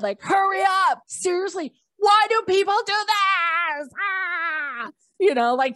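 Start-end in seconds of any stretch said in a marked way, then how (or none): 0.2-3.9 retold inner scream. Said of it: "I'm just screaming in my head". 4.0-4.9 high, exasperated "ah" without much volume; mock frustrated scream. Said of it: "I'm just screaming in my head".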